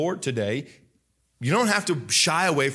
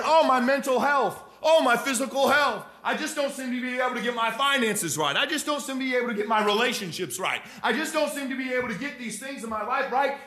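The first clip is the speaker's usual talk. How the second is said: higher, fretful complaining voice; mock the worried believer, pitched up; whining churchgoer; 10 semitones higher than usual. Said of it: "So many people are wondering".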